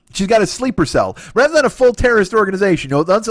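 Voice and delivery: usual speaking voice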